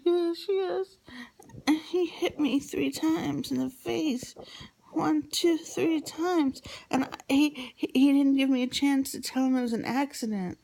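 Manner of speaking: put-on very high, pleading child's voice; imitating upset child. Child insisting to parent that Daddy hit her, upset high voice. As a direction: retell it in a high, distressed little voice.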